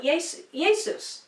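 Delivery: Jesus with a Y sound and an oo ending; "Jesus" pronounced YAY-soos; old Latin pronunciation. Shown as a demonstration. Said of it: "the way that Messiah's name was written and pronounced".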